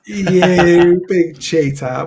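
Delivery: drawn-out, very slow cheater through a laugh; laughing accusation. Real, not acted. Host laughs at his co-host for cheating him into the taboo topic.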